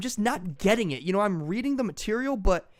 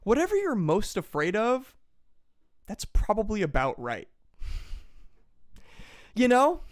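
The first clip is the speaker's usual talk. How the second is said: ordinary talk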